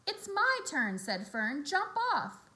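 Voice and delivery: insistent. Acted. Fern demands her turn.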